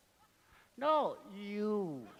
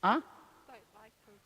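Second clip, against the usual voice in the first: normal talking voice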